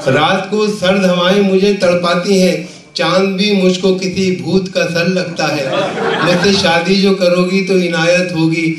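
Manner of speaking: measured, projected Urdu verse recital; comic poet reciting with rhythmic phrasing; mushaira recitation. Acted. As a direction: declaim the couplets to a live audience, line by line.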